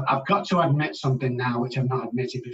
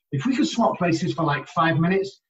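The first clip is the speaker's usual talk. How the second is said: normal talking voice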